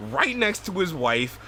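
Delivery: normal talking voice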